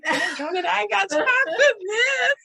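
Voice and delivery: high, laughing self-scolding, half crying; laughing at herself. Retold or imitated. Talking herself out of a week of resentment, laughing. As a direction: scold yourself through laughter. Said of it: "and I'm like".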